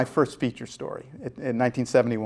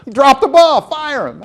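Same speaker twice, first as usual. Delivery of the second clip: much higher, louder, slow mock demand; mock angry boss; 13 semitones higher, 12 dB louder, slower than usual. George Steinbrenner fuming at the manager about a player. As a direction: ham up an angry owner. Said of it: "getting upset and turns to me and says".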